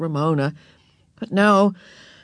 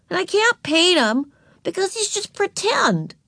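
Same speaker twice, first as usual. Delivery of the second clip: put-on much higher child voice; stubborn little girl; 11 semitones higher than usual. A narrator's reading. Ramona defends her painting to Beezus, stubborn child. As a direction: insist in a high little-girl voice.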